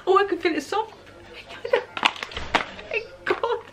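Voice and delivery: ordinary delivery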